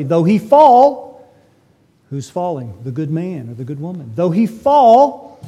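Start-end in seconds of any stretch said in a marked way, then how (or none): 0.0-1.1 slow emphatic Though he fall; stressed fall. Acted. Preacher stresses key scripture word. 4.1-5.4 slow emphatic Though he fall; stressed fall. Acted. Preacher repeats key scripture phrase.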